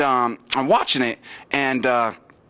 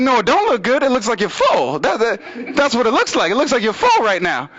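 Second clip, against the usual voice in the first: high-pitched comic indignation; exasperated retort; 7 semitones higher than usual. Retold or imitated. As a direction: protest with raised pitch, driving each point home.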